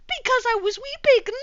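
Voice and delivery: high and loud mock wail; hammed-up wail. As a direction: overplay the weeping. Reading melodramatic line.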